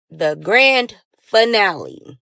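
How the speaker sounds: slow, stretched-out teaser title; drawn-out announcement. Real, not acted. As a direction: stretch each word to build up the finale. Announcing the next part's title.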